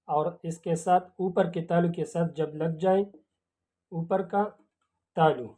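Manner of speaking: quietly walk through where the tongue goes; soft explaining. Shown as a demonstration. Teacher softly showing the class where the sounds form. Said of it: "explaining tongue position softly".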